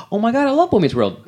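put-on high, gushing "Oh my God, I love Boy Meets World"; mock fan gush.

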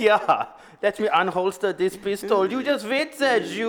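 put-on comic German accent, climbing in pitch; mock German accent. Acting a Nazi unholstering his pistol, mock German accent. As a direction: ham up the German accent as a cartoon villain. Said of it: "Why is your German accent sounding like".

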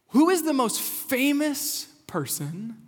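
slow, drawn-out question pitched high; building suspense. Real, not acted. Pastor puts his big question to the congregation, building suspense. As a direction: pose it grandly, stretching FAMOUS.

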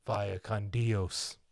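low, very slow, soft movie sign-off; drawn-out, hushed imitation of a surfer hero's farewell; Keanu. Quoting Keanu's line from the very end, slow low farewell. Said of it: "when Keanu gives the".

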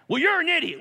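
loud, high mocking jab; high, loud scornful jab; mock insult. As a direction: fling it like a petty insult. Mock quote in church fight.

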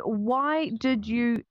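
slow and deliberate for a foreign guest; speaking slowly. Real, not acted. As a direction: stretch every word out slowly. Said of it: "and I'm trying to speak slowly".

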